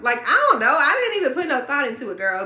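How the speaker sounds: loud, high, fast defence; heated. Real, not acted. Telling the audience she did nothing wrong, loud and quick.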